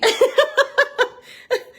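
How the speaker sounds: shrieking laugh; high squeal of laughter. Real, not acted. Laughs at own dog voice.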